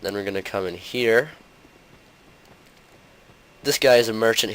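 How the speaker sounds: ordinary delivery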